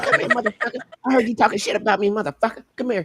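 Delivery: Mike Tyson voice. Retold or imitated. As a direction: menace like a boxer calling you out. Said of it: "That's when he's like".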